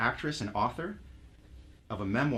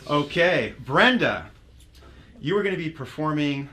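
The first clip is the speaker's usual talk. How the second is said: ordinary talk, nothing marked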